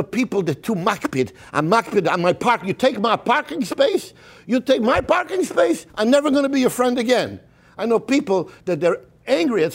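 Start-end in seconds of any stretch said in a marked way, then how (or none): 2.6-7.4 indignant grudge. Retold or imitated. Acting out a petty parking grudge.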